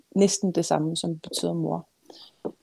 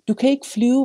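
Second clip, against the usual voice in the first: higher, louder warning cry; read-aloud shout; 7 semitones higher, 5 dB louder than usual. A narrator's reading. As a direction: shout it up to the child. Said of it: "råbte hun".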